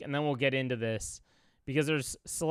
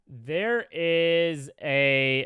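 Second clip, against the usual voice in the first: ordinary talk, nothing marked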